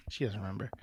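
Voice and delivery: usual speaking voice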